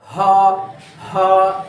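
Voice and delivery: teaching the heavy ha; throat sound demo. Shown as a demonstration. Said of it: "इसमें vibrate होगी".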